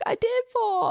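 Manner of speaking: high mock little-boy whine; high-pitched pouty kid voice; crying child. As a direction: imitate an upset child protesting. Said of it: "so he's crying and".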